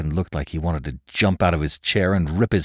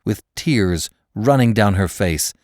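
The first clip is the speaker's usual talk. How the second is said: ordinary delivery, nothing marked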